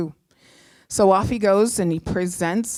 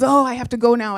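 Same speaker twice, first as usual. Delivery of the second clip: higher, louder burst of excitement; excited retold boyfriend; 4 semitones higher, 5 dB louder, faster than usual. Excited boyfriend tells his girlfriend he must go celebrate, excitedly. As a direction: blurt it out in an excited rush to leave. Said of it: "And he's so excited".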